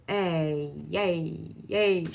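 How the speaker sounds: little giddy cheer; excited cheer. Real, not acted. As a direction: cheer it out happily. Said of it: "I don't know why I did that".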